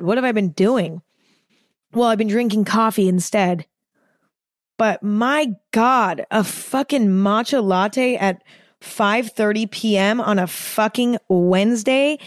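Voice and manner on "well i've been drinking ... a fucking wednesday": ordinary talk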